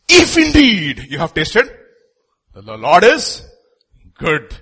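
rising preacher's call; fervent preaching. Real, not acted. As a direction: lift it up as a challenge to the congregation. Preaching peak on tasted.

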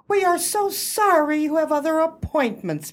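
normal talking voice

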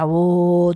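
imitating the constant buzz in the bathroom; humming imitation. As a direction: mimic the droning noise. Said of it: "en el lavabo se escucha".

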